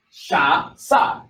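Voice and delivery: sha with sh, then sa with plain s; sha versus sa. Shown as a demonstration. Teacher contrasting the two letters, sha then sa. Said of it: "You see the difference?".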